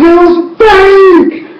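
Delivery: very high, slow, loud gasp of discovery; astonished fan at the big reveal; shocked reveal. Retold or imitated. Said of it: "and like".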